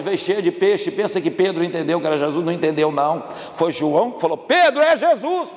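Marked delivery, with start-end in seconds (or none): 4.4-5.5 high, slow, drawn-out call; excited calling out. Acted. John alerts Peter in the boat, calling out. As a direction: cry the news out to a friend.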